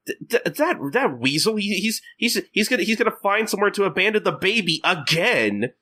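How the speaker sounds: high, stammering mock outrage; outraged Kagura. Kagura outraged at fleeing Gin.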